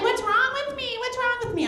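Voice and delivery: high mock self-pity; whining friend. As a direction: moan it like a helpless friend. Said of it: "she's always like".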